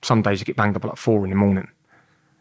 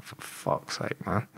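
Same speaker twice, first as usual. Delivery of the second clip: whispered, much softer, slow fed-up curse; muttered curse; 12 dB quieter, much slower than usual. Retold or imitated. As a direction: breathe it out under your breath. Frustrated mutter after losing visit.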